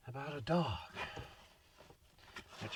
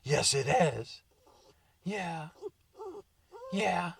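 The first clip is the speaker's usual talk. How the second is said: put-on dog baby talk; 8 semitones higher, much slower than usual. Cooing at the dog.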